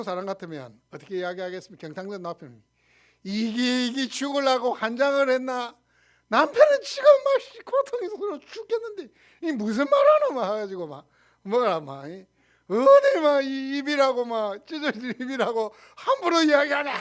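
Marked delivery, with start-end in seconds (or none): 3.2-10.3 high, loud hammed-up rage in Gyeongsang dialect; Gyeongsang husband yelling. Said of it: "막 이렇게 고래고래 고함을 지를 건데". 12.1-16.9 Gyeongsang husband yelling. Acted. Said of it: "막 이렇게 고래고래 고함을 지를 건데".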